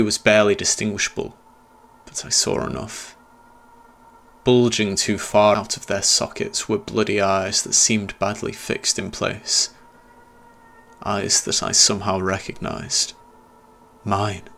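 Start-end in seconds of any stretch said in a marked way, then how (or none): none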